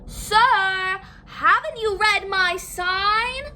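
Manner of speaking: high, loud and slow, pointing at the sign; indignant shout. A narrator's reading. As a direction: insist loudly, dragging each word. Dentist refusing fox at door.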